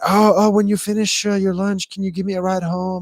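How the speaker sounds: playfully mock her begging for a lift; mimicking the woman. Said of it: "And then".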